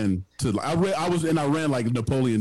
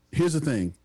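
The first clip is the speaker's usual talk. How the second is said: ordinary talk, nothing marked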